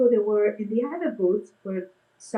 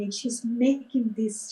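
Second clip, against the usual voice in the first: ordinary talk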